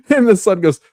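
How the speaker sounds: high, loud words through laughter; real laughing retelling. Real, not acted. Podcaster retells son missing dad's metaphor, cracking up. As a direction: crack up while delivering the punchline.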